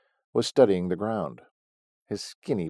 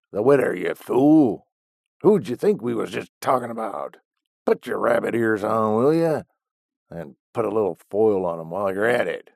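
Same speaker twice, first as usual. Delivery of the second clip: louder, cranky rebuke of a fool; scolding old man; 2 semitones higher, 6 dB louder than usual. A narrator's reading. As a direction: snap at your dim old partner. Stogie scolding Willie.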